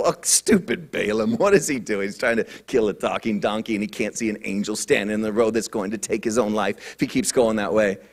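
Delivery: low, muttered scorn; mocking scoff. Listeners scoff at Balaam, low mocking mutter. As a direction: sneer at a foolish man. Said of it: "we can look at this story and go".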